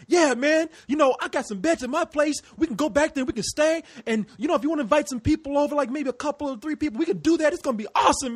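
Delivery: high-pitched, breezy mock enthusiasm; imitating eager friend. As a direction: pitch it up like an over-keen host. Friend's keen invitation retold.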